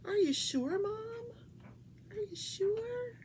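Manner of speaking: lightly high pet voice; a touch of a high pet voice; voicing the pet. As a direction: speak for the dog sweetly. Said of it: "She's like".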